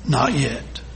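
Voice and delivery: a kind potter quietly refusing; soft low steady "not yet"; gentle firm master. A narrator's reading. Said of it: "smiled gently".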